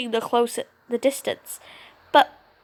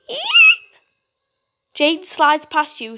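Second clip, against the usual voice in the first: normal talking voice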